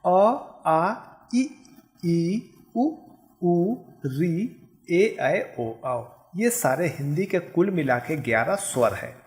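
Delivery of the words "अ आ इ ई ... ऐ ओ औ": each vowel said apart, अ आ इ ई, clearly; vowel recitation. Shown as a demonstration. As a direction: recite the vowels one by one for the class. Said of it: "इसे उच्चारण करके एक बार देख लेते हैं".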